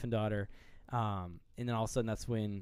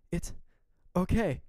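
mock reassurance; 6 semitones higher than usual. Doctor at the door reassuring the chained man, mock. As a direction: reassure him falsely, hammed-up. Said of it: "he turns back around and he goes".

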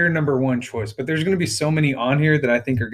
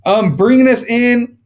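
host announcer; 7 semitones higher, 6 dB louder, slower than usual. Acted. Host opening segment.

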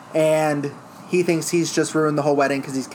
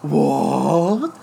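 drawn-out what. Retold or imitated. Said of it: "it's lower, it's higher".

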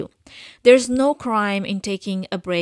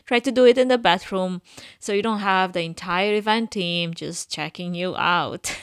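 ordinary talk

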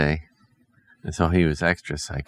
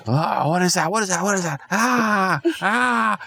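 mock panic; 13 semitones higher than usual. Psycho panics at goo.

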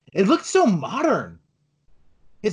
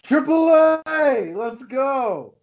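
high, loud, slow drawn-out cheer; hyped cheer; 9 semitones higher, 5 dB louder, much slower than usual. Real, not acted. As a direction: stretch it out like a hype chant. Hyped rating call.

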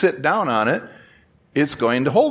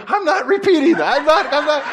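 high, laughing protest; mock-panicked refusal through laughter; imitated balking interpreter; 10 semitones higher than usual. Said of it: "the interpreter's going".

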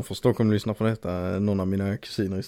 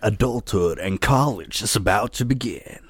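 loud, slow movie-trailer announcer; 4 dB louder, slower than usual. Pretend. Parodying the American Pie trailer, loud announcer voice. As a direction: sell it like a film trailer.

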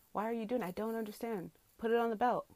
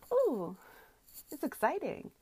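higher, louder jovial customer voice; 8 semitones higher, 6 dB louder, slower than usual. Retold or imitated. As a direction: play the cheerful lady thrilled by mystery greens. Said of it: "In this fun, kind of jovial".

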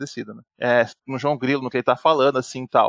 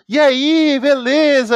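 high, loud, overly cheerful greeting; bright loud hello from an acquaintance; cheery greeting; 10 semitones higher, 7 dB louder, much slower than usual. Retold or imitated. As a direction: greet like a long-lost buddy out of nowhere. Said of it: "a pessoa já".

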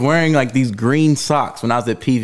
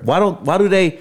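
usual speaking voice

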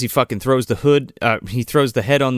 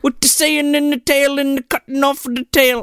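Bill Cosby impression; 12 semitones higher than usual. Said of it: "doing his best fucking Bill Cosby".

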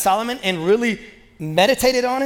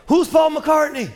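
put-on confused young fans; 7 semitones higher, 3 dB louder, slower than usual. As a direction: play the puzzled kid who never heard of him. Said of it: "young people are like".

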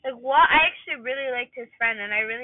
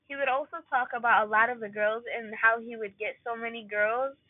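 ordinary delivery

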